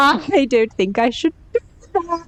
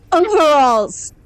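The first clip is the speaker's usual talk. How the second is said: ordinary talk, nothing marked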